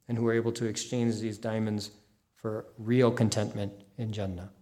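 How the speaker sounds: closing prayer. Real, not acted. Closing supplication for the audience.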